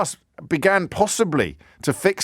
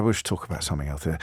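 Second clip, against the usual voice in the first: hurried dismissal; 11 semitones lower, 9 dB quieter, faster than usual. Retold or imitated. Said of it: "we go".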